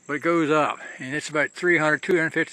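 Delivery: normal talking voice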